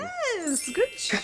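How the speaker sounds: high, bright "yes"; excited praise. Real, not acted. Host cheers when her partner guesses right.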